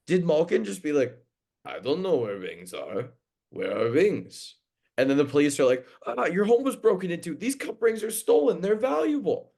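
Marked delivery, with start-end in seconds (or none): 1.6-4.6 lightly put-on goofy voice, slower on the last question; a hint of a clueless athlete, joking; silly Gino voice. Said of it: "that voice I did imitating Gino". 6.1-9.5 high, rising put-on cop voice; squeaky hammed-up policeman; mock police. Mocking police in retold scene.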